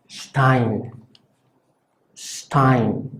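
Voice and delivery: Stein: la s casi sh, dientes juntos; s cerrada alemana. Shown as a demonstration. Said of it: "como si tratáramos de decir la S, pero juntando los dientes". (Stein con s cerrada)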